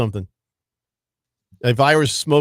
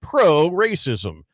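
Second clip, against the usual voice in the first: slow teasing emphasis; much slower than usual. Acted. Mock accusation aimed at Ralph.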